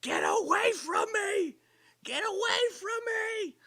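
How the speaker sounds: high, loud imitation of her yell; slow, high-pitched mocking shout. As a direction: mimic her drawn-out shriek. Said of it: "And she's yelling".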